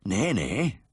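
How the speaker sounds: lightly husky Yorkshireman; gentle northern protest, a touch husky. A narrator's reading. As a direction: brush off sympathy in a husky northern voice. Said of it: "the husky voice went on".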